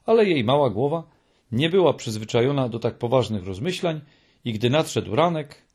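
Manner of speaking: ordinary delivery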